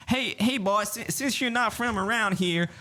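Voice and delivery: high, slow hammed-up Texas drawl. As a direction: imitate a busybody local in a high-pitched Texan accent. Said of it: "this is my Texas voice".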